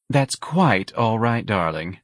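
ordinary delivery, nothing marked

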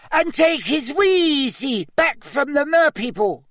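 very high, squeaky elf voice; squeaky house-elf. Acted. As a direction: squeak it like a tiny anxious elf. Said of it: "squeaked the elf".

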